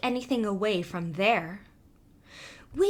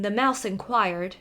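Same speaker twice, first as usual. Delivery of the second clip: ordinary delivery, nothing marked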